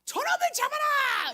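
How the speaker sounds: high, loud, slow furious shout; angry king's order. Retold or imitated. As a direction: roar the order like an enraged king. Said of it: "여로보암 왕이 화가 나가지고".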